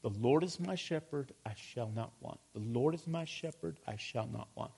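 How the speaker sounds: soft self-murmur, each phrase dropping low and quiet; low muttering. Shown as a demonstration. Preacher demonstrates meditating on God's word, low soft mutter. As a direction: mutter the verse privately, letting it fall away. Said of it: "in a low tone of voice".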